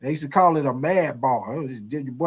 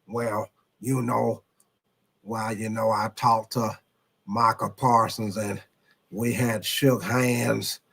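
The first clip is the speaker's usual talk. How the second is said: lower, slower drawling team owner voice; slightly lower, hushed Jerry Jones take; Jerry Jones impression; 5 semitones lower than usual. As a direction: settle down into an old Texas owner talking slow. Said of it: "you say it's a very good Jerry impression".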